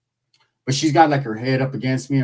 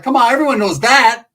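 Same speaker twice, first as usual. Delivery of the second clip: higher, louder scoffing protest; exasperated; 8 semitones higher, 5 dB louder than usual. Retold or imitated. Telling Ricky his guess is obvious, loud and scoffing. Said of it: "I'm like".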